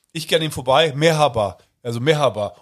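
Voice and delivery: ordinary talk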